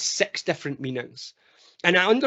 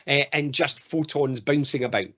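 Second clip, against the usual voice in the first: normal talking voice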